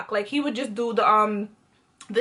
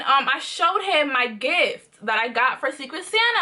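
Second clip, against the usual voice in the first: usual speaking voice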